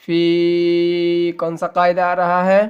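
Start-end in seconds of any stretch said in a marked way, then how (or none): 0.1-1.3 فی chanted with a stretched vowel; ya madd. Shown as a demonstration. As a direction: hold the "ee" of فی long and level. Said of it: "یا مدہ میں ایک الف کے برابر کھینچیں گے".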